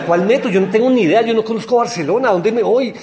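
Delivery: panicked protest. Retold or imitated. Retold panic about the metro.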